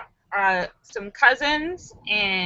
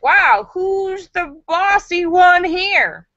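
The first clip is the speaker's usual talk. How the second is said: slow, higher teasing question; mock teasing onlookers; 8 semitones higher, 4 dB louder, much slower than usual. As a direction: tease the two cooks slowly. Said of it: "Everyone's like".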